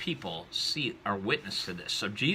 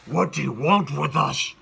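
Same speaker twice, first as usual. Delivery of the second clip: loud, raised demon roar; terrifying demonic voice; 5 semitones higher, 10 dB louder than usual. Acted. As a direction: bellow like a demon, a destroyer of souls. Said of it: "terrifying voice that sounds demonic".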